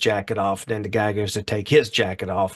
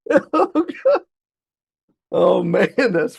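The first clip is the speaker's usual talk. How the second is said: laughing; 3 dB louder, much slower than usual. Real, not acted. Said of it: "laughing fit".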